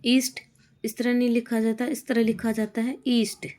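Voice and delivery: usual speaking voice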